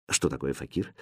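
low, hushed aside; quiet question. A narrator's reading. Princess secretly asking her governess, quietly. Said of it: "тихо спросила принцесса".

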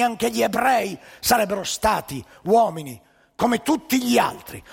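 normal talking voice

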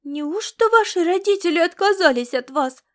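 put-on high, loud childlike question; astonished young girl. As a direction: ask wide-eyed, as a shocked child. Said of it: "спросила девчонка, глядя на неё широко распахнутыми".

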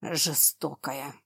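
slow, soft sulky grumble; grumbled. A narrator's reading. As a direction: grumble it under your breath. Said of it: "проворчал дракон".

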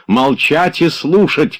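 slow, high, loud bark of a command; shouted order. A narrator's reading. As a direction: shout her down. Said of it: "крикнул Обломов".

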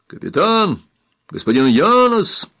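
calling out loudly. A narrator's reading. Rescuer calls trapped captain through the door, shouting. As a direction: hail someone beyond a thick door. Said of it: "закричал Каммамури, что было силы".

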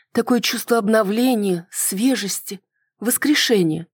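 high-pitched female character voice, wondering; woman's voice, searching for the feeling; pensive, moved woman. Acted. Woman recalls the speech, moved.

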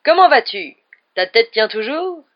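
loud, high, booming teasing; roaring greeting. A narrator's reading. Headless rider teasing his host, loud roar. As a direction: bellow it, then stretch the tease. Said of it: "rugit-il".

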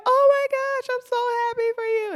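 very high, loud mock squeal; overjoyed friend. Friend celebrating the new job, exaggerated high excitement. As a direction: imitate a friend squealing congratulations. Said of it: "And she's like".

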